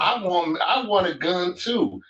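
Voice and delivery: high-pitched, playful insistence; mock proclamation. Blind man telling uneasy people he wants a gun, mock proclamation. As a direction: announce it to doubters with comic indignation. Mock address to uncomfortable listeners.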